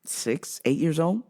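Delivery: ordinary delivery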